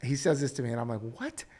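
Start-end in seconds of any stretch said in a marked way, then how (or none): 1.0-1.4 high, breathy disbelieving "What?"; a dad caught off guard; stunned "what". Retold or imitated. Retold reaction to son's claim.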